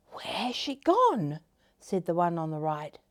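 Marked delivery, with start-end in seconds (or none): 0.1-1.4 raised pitch, drawn-out question; puzzled. Acted. Caterpillar finding the neighbour missing, puzzled. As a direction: wonder aloud where she went.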